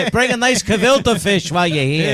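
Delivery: hammed-up Yiddish shouting at Satan; Yiddish accent.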